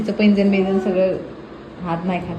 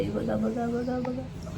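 hushed aside; 2 semitones higher, 15 dB quieter, much slower than usual. Real, not acted. Mother whispers at child eating.

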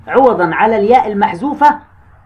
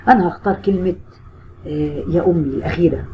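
soft, lower mock student voice; imitating the student; 3 semitones lower, 5 dB quieter than usual. Teacher imitating a student picking the wrong answer, softly. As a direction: act out the student quietly choosing the wrong answer. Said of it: "وتروح تقول إيه؟".

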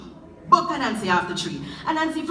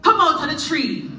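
high, loud call to the tree; 5 semitones higher, 5 dB louder than usual. Acted. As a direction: shout at the figure in the tree. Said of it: "So Anansi cried out".

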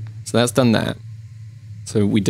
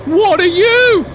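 high-pitched, half-comic screaming; shrieking alarm. Real, not acted. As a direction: shriek in baffled horror. Startled by strange creature.

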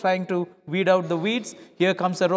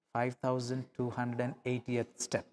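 much softer, lower, peaceful count; calm; 6 semitones lower, 12 dB quieter than usual. Acted. Second miler calmly reaching the final step, soft and peaceful. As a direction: let the last step pass in peace. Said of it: "gentle calmness of spirit".

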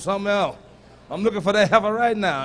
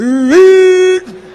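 strained read; 8 semitones higher, 10 dB louder than usual. Retold or imitated. Imitating boy reading aloud.

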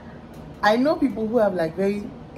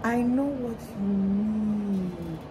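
slow, confused; 2 semitones higher, 7 dB quieter, much slower than usual. Retold or imitated. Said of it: "I was like".

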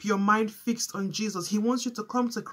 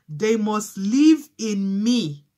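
slow, emphatic scripture line; slow reading; 6 dB louder, much slower than usual. Shown as a demonstration. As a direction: give each word its weight. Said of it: "I'm gonna read it slowly".

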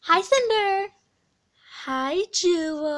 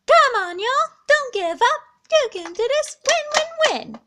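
high, loud cheerleader chant; pretend cheer; 9 semitones higher, 6 dB louder than usual. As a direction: pump the toy up like a cheerleader. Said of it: "thank you for the cheer".

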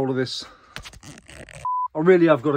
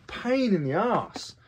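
weary groan; 6 semitones higher, much slower than usual. Real, not acted. Complaining about refunds.

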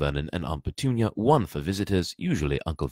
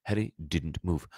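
ordinary delivery

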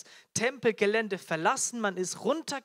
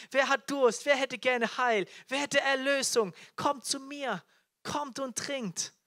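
high, raised calls of invitation; crying out; 5 semitones higher, 3 dB louder than usual. Acted. Jesus offers salvation to the thirsty, calling out. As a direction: call them in, slowing on the last invitation. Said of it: "der da schreit".

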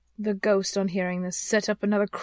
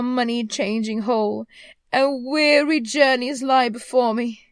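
raised, loud, lamenting phantom; tormented ghost; 4 semitones higher, 8 dB louder than usual. Acted. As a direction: declaim like a restless, regretful spirit. Voices the ghost in the story.

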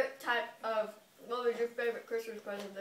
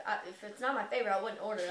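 ordinary delivery

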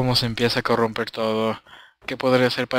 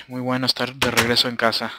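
ordinary talk

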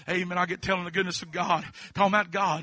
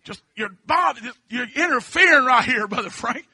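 mock impatient; 5 semitones higher, 4 dB louder than usual. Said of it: "in my mind, I think".

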